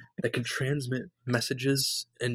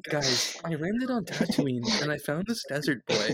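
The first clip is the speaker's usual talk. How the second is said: influencer vlog voice; 6 semitones higher, slower than usual. Pretend. Luke as a social media vlogger.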